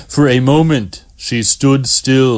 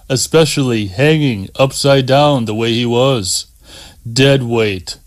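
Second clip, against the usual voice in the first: usual speaking voice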